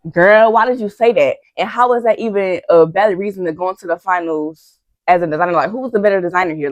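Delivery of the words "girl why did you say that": usual speaking voice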